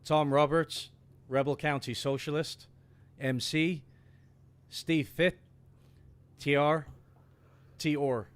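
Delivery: over-the-top Irish brogue for a live chat shout-out; hammed-up Irish accent. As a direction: read the list like a flamboyant Irish host. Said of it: "in your most egregious, flamboyant Irish accent".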